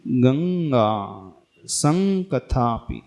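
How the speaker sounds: गंगा संकथापि slowly, both with long ng; teacher models गंगा संकथापि, ng resonating; drawn-out anusvara. Shown as a demonstration. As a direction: hold the nasal ng in गंगा and संकथापि. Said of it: "ऐसा".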